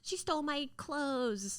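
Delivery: high, drawn-out mock whine; classic sister complaint. Imitating a sister tattling about borrowed things, whined. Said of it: "that classic, like".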